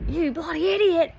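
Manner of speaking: loud high scolding outburst; angry dad. Retold or imitated. Dad yelling at his son for grabbing a venomous snake, loud and angry. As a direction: bark it at your son, furious.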